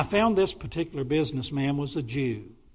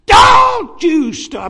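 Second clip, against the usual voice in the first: furious, slow shout, much higher and louder; angry yell; 17 dB louder, much slower than usual. Retold or imitated. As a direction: bellow it to cut him off. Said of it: "he yelled at me".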